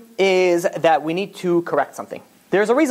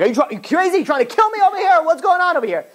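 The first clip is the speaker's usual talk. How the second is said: much higher-pitched mock outrage; worked-up, high-pitched acted protest; indignant outburst; 11 semitones higher than usual. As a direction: act out a startled passerby telling someone off. Retold victim of a falling rock.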